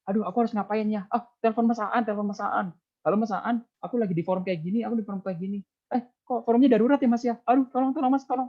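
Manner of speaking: high, hammed-up panicked plea for help; flustered, pleading, pitched high; mock panic. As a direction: play a speaker frantically phoning for rescue. Acting panicked speaker calling for help.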